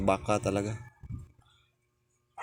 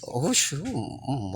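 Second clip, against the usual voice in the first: sudden high yelp at the heat; startled by spicy broth; surprised at spice; 7 semitones higher, much slower than usual. Real, not acted. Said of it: "manghang pala". (gulat sa anghang)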